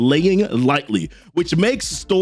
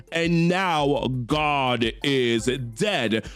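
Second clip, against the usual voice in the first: very slow, lightly voiced cold finality; a hint of a ruthless king, drawn out; savage; 3 semitones lower, 5 dB quieter, much slower than usual. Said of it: "in a savage way here".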